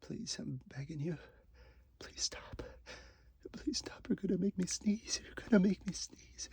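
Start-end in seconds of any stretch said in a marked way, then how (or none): none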